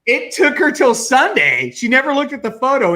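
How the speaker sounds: ordinary talk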